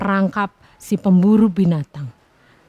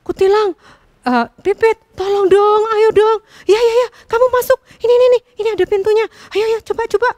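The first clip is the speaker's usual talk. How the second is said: high, loud, rushed nagging; impatient bulbul pushing the sparrow, high and fast; impatient urging; 10 semitones higher, 4 dB louder, faster than usual. A narrator's reading. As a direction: hurry her along, faster and faster. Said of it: "semakin tidak sabar".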